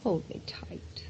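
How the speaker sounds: whispering. Acted. Wife whispering to husband.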